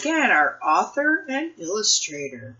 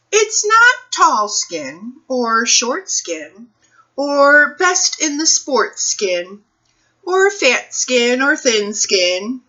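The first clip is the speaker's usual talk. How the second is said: lively, sing-song picture book read-aloud, slower and higher; reading to children; 5 semitones higher, 7 dB louder, slower than usual. Acted. Librarian reading a picture book to children, expressive storytime voice. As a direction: read it like storytime for little kids, savoring each rhyme.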